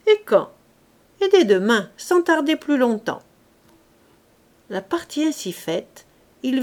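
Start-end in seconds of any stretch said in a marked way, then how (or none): 0.1-0.5 high, loud, keen little cry; eager question. Acted. Gardener eagerly pressing the lord for a date, high and loud. 1.2-3.2 confident promise. Acted. Lord boasts he'll act at once.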